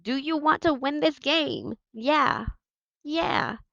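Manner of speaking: high team yells answering the captain; yelling in response. Acted. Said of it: "we started yelling".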